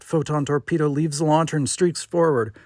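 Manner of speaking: ordinary delivery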